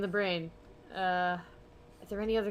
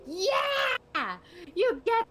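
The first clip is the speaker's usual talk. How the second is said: squeaky high mock yell, much louder; mock Max reply; 12 semitones higher, 10 dB louder, much slower than usual. Mocking Max's answer to Sam, in a squeaky loud yell. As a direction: yelp it up high like a cartoon.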